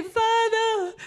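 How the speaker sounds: high, loud cry of Father; calling out. Retold or imitated. A troubled believer crying out to Father, high and loud. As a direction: call out to Father in your trouble. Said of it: "and we say".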